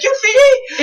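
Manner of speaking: very high, loud yell. Retold or imitated. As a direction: yell at the team to end it. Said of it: "vous faites".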